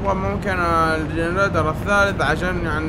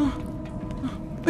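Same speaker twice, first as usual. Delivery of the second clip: silent fearful gasp; breathless gasp; 8 semitones higher, 8 dB quieter, much slower than usual. Real, not acted. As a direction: gasp in terror. Terrified gasp. (شهقة خوف)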